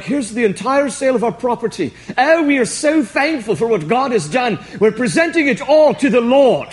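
hammed-up British churchgoer showing off his offering; exaggerated, sanctimonious British voice; sudden British accent. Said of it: "I don't know why I just went British there".

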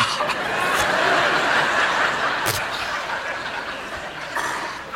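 breathy, airy burst of laughter; real laugh. Real, not acted. Pastor cracks up telling the congregation the story, long airy laugh. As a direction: let a long silent laugh shake through.

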